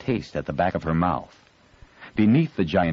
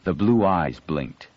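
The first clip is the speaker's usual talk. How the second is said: ordinary delivery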